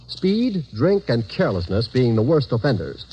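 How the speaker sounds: ordinary talk, nothing marked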